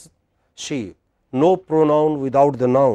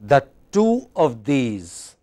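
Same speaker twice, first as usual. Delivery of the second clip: slow verse, "two" held long and falling; sing-song; much slower than usual. Shown as a demonstration. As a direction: stretch "two" and "these". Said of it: "it's like sing song".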